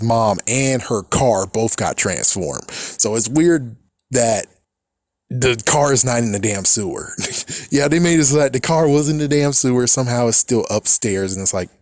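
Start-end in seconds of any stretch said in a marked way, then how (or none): none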